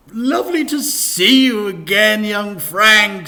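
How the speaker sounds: high, loud, over-eager vicar voice; high loud cheery vicar; gushing vicar. Acted. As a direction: beam it out like a toothy vicar. Vicar's voice in story.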